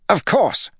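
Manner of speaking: high, loud bark. A narrator's reading. Lord snapping at the young lord, barked. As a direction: bark it dismissively. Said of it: "Jared barked".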